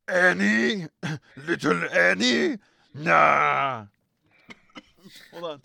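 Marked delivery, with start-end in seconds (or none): 0.1-3.9 high, loud, gravelly alien trader; high, loud alien junk dealer; Watto voice. Acted. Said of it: "that was going full Watto there".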